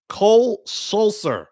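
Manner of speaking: COLE SULSER slow, high, each word separate; re-say "Cole Sulser" deliberately after garbling it; slow, emphatic name with a gap between words; careful enunciation. Shown as a demonstration.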